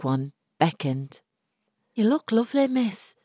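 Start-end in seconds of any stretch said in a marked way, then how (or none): 1.9-3.1 put-on higher, gentle young maid; timid servant girl. A narrator's reading. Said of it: "Molly said".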